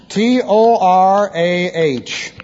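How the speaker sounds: slow, drawn-out letter by letter; measured dictation of single letters; spelling out. Real, not acted. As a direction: spell it slowly for note-takers. Spells out Hebrew term.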